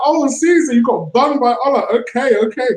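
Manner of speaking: high, teasing retold jab at a mate; mock teasing. Teasing a friend who lost at FIFA, high and mocking. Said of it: "I was like".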